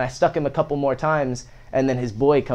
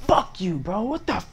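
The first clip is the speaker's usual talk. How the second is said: retold angry outburst; 7 semitones higher than usual. Telling off the kid who cracked his phone, retold indignant outburst. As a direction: pitch leaps up on the mock-outraged curse.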